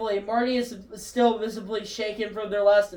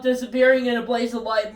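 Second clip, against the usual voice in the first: ordinary talk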